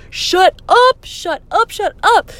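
high, loud delighted disbelief; thrilled squeal. Retold or imitated. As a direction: squeal with joy at the news. Friend offers front seat.

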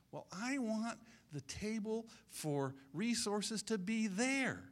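high, drawn-out demanding voice; mock someone who must have their way; self-centred insistence.

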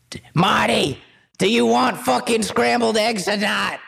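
high, put-on old wife voice; hammed-up cranky spouse, pitched high; nagging old wife. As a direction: holler at your husband from the kitchen. Acting old married couple at home.

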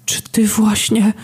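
very high, slow stunned question; frightened. A narrator's reading. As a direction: gasp it in horror. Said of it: "szepnęła przerażona otchłań".

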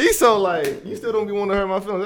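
laughing through the words; high laughing tease. Real, not acted. Teasing friend, laughing.

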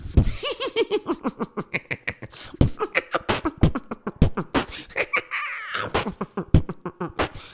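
long breathy villain cackle; airy maniacal laugh; Joker cackle. Shown as a demonstration. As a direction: let the Joker laugh roll out. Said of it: "Manda um completo aí".

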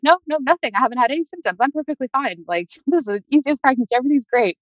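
high-pitched, airy retold reassurance; breezy denial. Patient assuring the tech all is fine, high breezy retelling.

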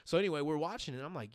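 ordinary talk

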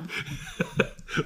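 breathy squeal of laughter; squeaky high laugh. Real, not acted. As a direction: let a tiny squeak out. Laughing at the joke.

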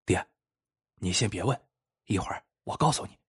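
softly, keeping it from the woman; low hushed voice to his father; a quick hushed word to dad; hushed aside. Acted. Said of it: "小声说".

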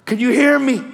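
high, urgent call. Retold or imitated. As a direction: call out to someone barely conscious. Calling to injured crash victim.

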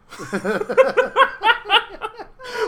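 very high, loud laughing fit; high-pitched burst of helpless laughter. Real, not acted. Host cracking up at the contestant's answer, high loud laughter.

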